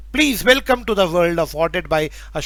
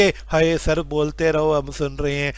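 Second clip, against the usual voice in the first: sleepy student voice; 5 semitones lower, 3 dB quieter than usual. Retold or imitated. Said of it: "ये नहीं कि".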